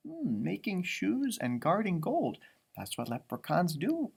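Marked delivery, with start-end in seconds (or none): none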